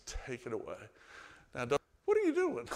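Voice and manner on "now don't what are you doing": high, loud, fast mock protest; indignant outburst. Martha scolding Jesus at the tomb, high and loud. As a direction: squeal it out like an offended sister.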